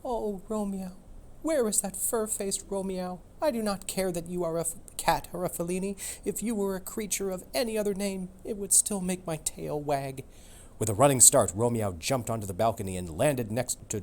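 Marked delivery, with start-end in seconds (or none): 0.0-10.2 put-on much higher, dreamy girl-dog voice; Juliet voice. Juliet the dog pining for Romeo, high and dreamy. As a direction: sigh it down from a balcony, lovesick and high.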